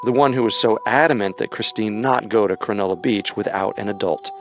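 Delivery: ordinary talk, nothing marked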